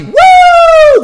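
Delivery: huge high whoop; whooping cheer. Shown as a demonstration. Trainer cheering on his dog in a huge whoop. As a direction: let out a wild cheer. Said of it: "I train with my dog".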